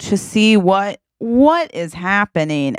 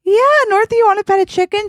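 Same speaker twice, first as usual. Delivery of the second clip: put-on high, louder babying voice; sweet coaxing; 4 dB louder, much faster than usual. Twin coaxing North to pet a chicken: high sing-song. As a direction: coax a little kid with a treat.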